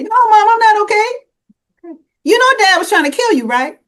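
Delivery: put-on high, loud, fast outburst of a distressed teen; upset daughter.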